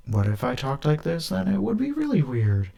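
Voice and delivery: jumps way up into a strange high voice; weird put-on voice. Shown as a demonstration. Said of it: "I just keep talking like that".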